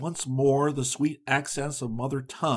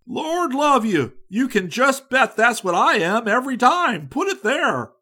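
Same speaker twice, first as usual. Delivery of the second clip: boisterous enthusiasm; 10 semitones higher, 8 dB louder than usual. A narrator's reading. Boisterous young American greets.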